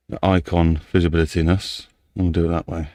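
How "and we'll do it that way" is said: usual speaking voice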